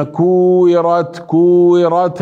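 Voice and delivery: كورت with long oo, single w; madd, not shadda. Shown as a demonstration. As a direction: stretch oo in each كورت. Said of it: "هذه ليست شدة". (كورت بالمد لا بالشدة)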